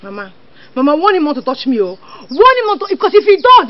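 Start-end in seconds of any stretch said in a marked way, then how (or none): 2.3-3.7 raised, high-pitched warning; angry warning. Acted. Sibling complaining to mama about being touched, angry warning. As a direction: warn them angrily, voice climbing. Threat during family quarrel.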